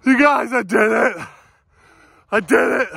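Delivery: breathless, high-pitched joy; elated. Real, not acted. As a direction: shout out the win, thrilled. Just broke nine minutes, elated.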